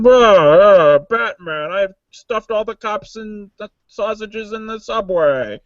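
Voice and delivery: muffled villain voice with a loud opening growl; garbled, theatrical villain threat; Bane into a cup. Shown as a demonstration. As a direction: demonstrate a hammed-up masked villain. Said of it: "At least talking to a cup or something".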